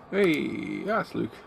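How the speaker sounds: ordinary talk, nothing marked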